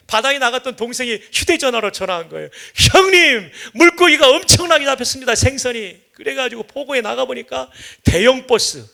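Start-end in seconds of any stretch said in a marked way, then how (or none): 2.9-6.0 excited phone call. Retold or imitated. Brother phones about huge catch.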